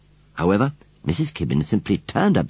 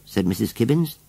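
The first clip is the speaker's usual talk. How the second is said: ordinary delivery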